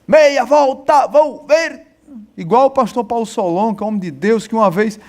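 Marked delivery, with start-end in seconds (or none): 0.1-1.9 slow barked army order; drill command. Retold or imitated. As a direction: call it like a drill corporal. Imitating a military drill command. (voz de comando militar)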